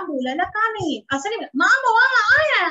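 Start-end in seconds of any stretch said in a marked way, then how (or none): 1.6-2.7 put-on high, loud wailing child; crying child voice. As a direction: wail like a frustrated little kid. (रोते हुए बच्चे की ऊँची, तेज़ आवाज़)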